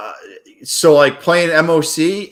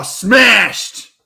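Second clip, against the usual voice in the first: loud, high triumphant yell; victory shout; 7 semitones higher, 5 dB louder than usual. Real, not acted. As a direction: belt it like a big win. Celebrating winning trade.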